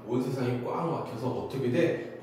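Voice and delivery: ordinary delivery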